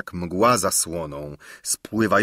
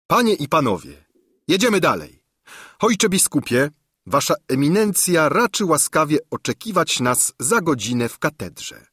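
raised, ringing king's announcement; young king announcing; 7 semitones higher than usual. A narrator's reading. King announcing departure to his guests, raised and ringing. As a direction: call the court to move on.

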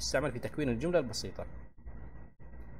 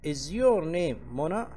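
very slow, a bit higher and louder, rising on your; slow model question; 4 semitones higher, 3 dB louder, much slower than usual. Shown as a demonstration. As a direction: ask the example slowly. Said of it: "زي هنا تقول". (سؤال نموذجي ببطء مع رفع الصوت)

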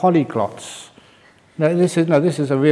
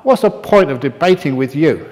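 a proud scholar scolding the monk; angry rant; 5 semitones higher, 5 dB louder than usual. Acted. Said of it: "the professor stood up and said".

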